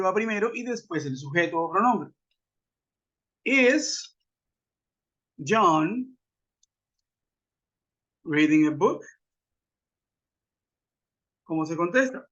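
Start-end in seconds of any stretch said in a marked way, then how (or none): 3.4-9.0 word-by-word question. Shown as a demonstration. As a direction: separate words, rising at the end. (pregunta dictada palabra por palabra)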